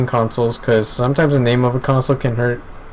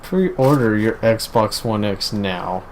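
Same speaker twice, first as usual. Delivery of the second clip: mock ad read; 3 semitones lower, 4 dB quieter, slower than usual. Parodying an ad.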